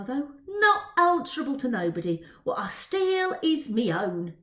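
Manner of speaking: swagger, show off the loot; Dodger's proud cockney. Acted. Dodger bragging to Twill about his loot, loud cockney swagger.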